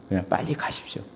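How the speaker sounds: urgent whispered advice. Retold or imitated. Pastor whispering advice to the deacon on the phone. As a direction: hush it like a secret warning. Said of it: "제가 그랬어요".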